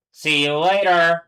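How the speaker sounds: loud slow mock farewell; loud sign-off. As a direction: sing out a drawn-out goodbye.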